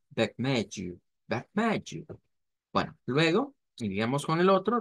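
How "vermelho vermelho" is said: the aspirated variant. Shown as a demonstration. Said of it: "suena como una J". ("vermelho" con H aspirada)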